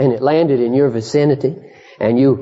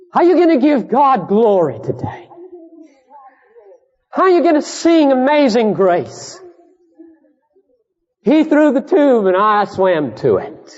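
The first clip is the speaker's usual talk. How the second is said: high, challenging sermon questions, falling at each end; impassioned preaching; 12 semitones higher than usual. Real, not acted. Preacher challenges congregation on how they will give God glory, impassioned. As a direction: press the congregation hard.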